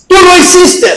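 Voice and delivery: acusación aguda, levemente actuada; acusación infantil. Retold or imitated. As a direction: imita a una niña que acusa. Hermanita acusa a la otra. (acusación de niña, imitada)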